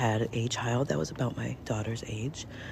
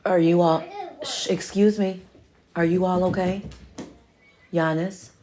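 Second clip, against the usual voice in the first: loud, higher call across the room; 5 semitones higher, 5 dB louder than usual. Real, not acted. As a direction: call out to check on them. Calling out to kids.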